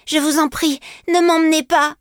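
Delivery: high-pitched desperate plea; imploring child's cry. Acted. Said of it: "m'écriai-je".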